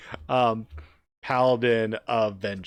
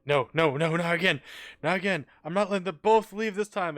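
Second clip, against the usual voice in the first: higher, quickening desperate plea; desperate refusal; 5 semitones higher, 3 dB louder, faster than usual. Acted. Game player voicing Shenron seeing her fade, desperate. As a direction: plead in character, speeding up at the end. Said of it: "he's just going".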